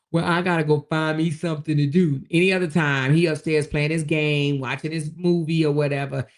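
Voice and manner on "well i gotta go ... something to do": sulking husband. Retold or imitated. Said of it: "He was like".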